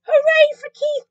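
very high, loud cheer; jubilant cry. A narrator's reading. Cats celebrating Keith, jubilant. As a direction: hail the hero joyfully. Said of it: "cried the cats".

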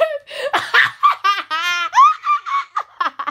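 loud high cackling laugh; maniacal laugh. Acted. Friend breaks into a loud unhinged laugh. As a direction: cackle like a movie villain. Manic Joker laugh bit.

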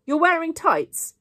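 loud, high, drawn-out accusation; accusing. A narrator's reading. Boy catching his friend out on crossed toes, accusing. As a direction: point it out as an accusation, slowly and loudly. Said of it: "he said accusingly".

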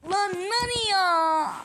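loud, slow, high lament; anguished wail. Real, not acted. Losing the Nani character in the match, a loud drawn-out wail. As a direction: cry out the name in despair. Wailing over his character.

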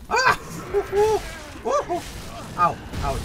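high, loud yelps of pain; pained screams. Real, not acted. Getting swarmed by enemies, shrieking in pain. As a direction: shriek at each hit. Screams while attacked in game.

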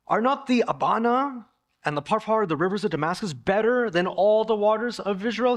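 high, loud scornful outburst; furious. Acted. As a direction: scoff at the muddy Jordan in anger. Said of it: "he's furious".

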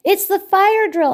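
high, loud excited shout; excited cry. A narrator's reading. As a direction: announce it to everyone with alarm. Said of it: "Octopus cried".

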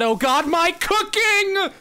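rising into a high, drawn-out shriek; mock panic. Peter suddenly remembering his cooking mid-chat, building panicked shriek. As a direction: start alarmed, climb to a squealed wail.

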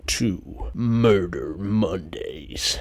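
slow, dark announcer voice; dramatic intro. Acted. Host opening his murder show, slow spooky announcer. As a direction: draw out the welcome like a horror host.

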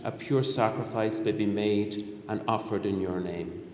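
normal talking voice

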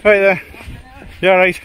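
loud, high friendly call. Real, not acted. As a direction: call out cheerfully to a passer-by. Greeting a passing cyclist.

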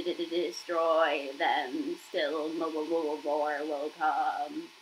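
slow, low, stuttering broken robot; glitching dying machine voice; garbled robot voice. Acted. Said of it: "spoke in a quiet, garbled voice".

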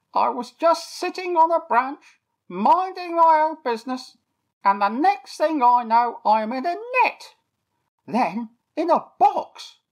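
a captured bird recounting his ordeal; toucan Terry telling his story. A narrator's reading. Toucan telling Eric how he was caught, high bird voice.

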